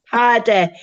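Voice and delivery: mock Birmingham teacher voice; Birmingham accent. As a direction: imitate the Birmingham teacher's greeting. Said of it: "quote the teacher. She was from Birmingham".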